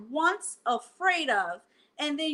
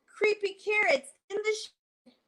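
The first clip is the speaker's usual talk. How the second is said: put-on scared child; 7 semitones higher than usual. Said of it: "Jasper screamed".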